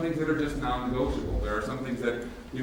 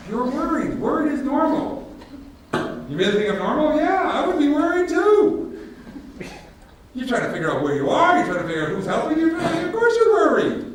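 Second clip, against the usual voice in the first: insistent retelling; 13 semitones higher, 7 dB louder than usual. Retold or imitated. Retells calming Steve, laughing.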